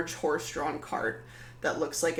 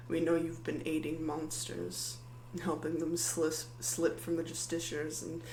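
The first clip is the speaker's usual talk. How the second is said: soft, measured paladin threat, softer; quiet menace; 7 dB quieter than usual. Acted. Paladin quietly accuses Maddie of aiding monsters, soft menace.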